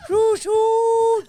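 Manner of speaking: very high, loud showman calls; hibachi chef. Retold or imitated. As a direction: ham it up like the chef lighting the volcano. Imitating hibachi chef's onion volcano.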